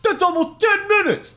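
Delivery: very high, loud exclamation; hammed-up high-pitched shock; exclaiming. A narrator's reading. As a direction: squawk it in astonishment. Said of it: "I exclaimed".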